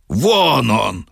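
acted exclamation of sighting; crying out. A narrator's reading. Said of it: "выкрикнула Дарья Петровна".

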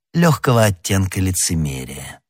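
ordinary delivery

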